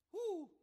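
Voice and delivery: excited preacher's woo; high joyful whoop. Real, not acted. Preacher whooping with joy at the crowd. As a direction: let out a happy whoop.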